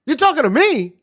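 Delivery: high, loud, outraged "you're talking to me?"; a wrestler snapping back at Liv, high and indignant; indignant retort. Retold or imitated. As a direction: fire it back, scandalised and loud.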